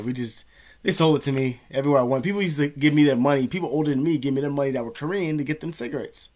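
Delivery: usual speaking voice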